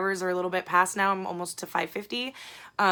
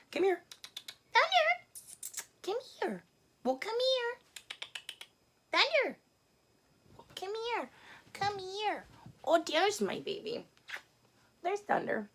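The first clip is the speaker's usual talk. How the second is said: much higher, soft, sweet coaxing voice; calling a cat; 14 semitones higher, 6 dB quieter than usual. Real, not acted. Owner softly calling her cat Thunder over, high sweet coaxing voice.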